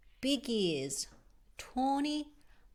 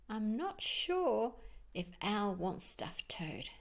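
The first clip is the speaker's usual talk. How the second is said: ordinary delivery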